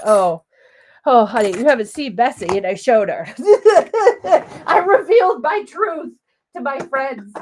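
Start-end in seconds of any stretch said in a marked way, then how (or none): 0.0-2.6 louder, quick, knowing sass; one-up her with a teasing oh honey; sassy oh honey. Retold or imitated. Crafter teasing a friend about her messy desk, sassy and quick. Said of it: "And I'm like". 3.3-4.6 loud, high burst of laughter; big laugh. Real, not acted. Crafter laughing after showing her desk, loud laugh.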